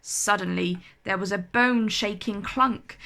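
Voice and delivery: plain reading voice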